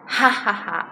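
acted "ha ha ha" guffaw; scornful laughter. A narrator's reading. Tallest blind man mocking his friends, laughing. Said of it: "简直忍不住大笑起来".

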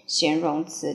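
normal talking voice

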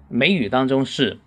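ordinary talk, nothing marked

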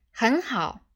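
short, indignant reply; angry. A narrator's reading. Computer answers an interrupting crew member, mildly angry. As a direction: answer with a touch of temper. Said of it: "电脑怒喝道".

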